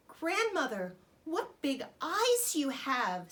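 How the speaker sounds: put-on girl growing suspicious of grandmother; wondering girl. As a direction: draw it out in wonder. Girl questions disguised wolf.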